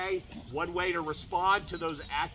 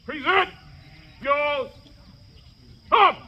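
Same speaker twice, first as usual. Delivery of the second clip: shouted command; 9 semitones higher, 5 dB louder, slower than usual. Acted. Drill commands to line.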